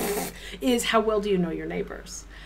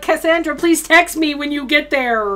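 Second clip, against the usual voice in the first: louder, higher fretful mom voice, half laughing; worried mom; 6 semitones higher, 5 dB louder than usual. Retold or imitated. Host to Cassandra, laughing mock worried mom voice. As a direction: fuss like a mom checking in, laughing. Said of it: "my full stream mom vibes would kick in".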